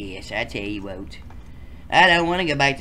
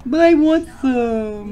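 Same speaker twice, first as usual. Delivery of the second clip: put-on whiny kid voice; 11 semitones higher, 7 dB louder, slower than usual. Whining character in game.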